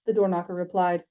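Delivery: ordinary delivery, nothing marked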